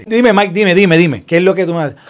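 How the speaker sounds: loud, high, repeated urging; imitated impatient prompting. President answering Mike Pence's interruption, mock impatient prompting. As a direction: cut in impatiently, acting out the president.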